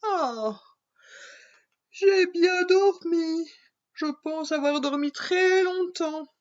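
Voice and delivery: a princess stretching awake after a long sleep; waking princess. Acted. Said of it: "s'étire en souriant".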